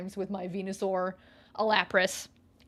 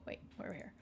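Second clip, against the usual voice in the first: ordinary talk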